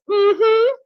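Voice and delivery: high pleased hum; delighted mm-hmm. Real, not acted. Tasting, delighted.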